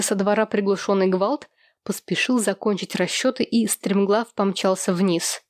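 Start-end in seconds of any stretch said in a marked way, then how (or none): none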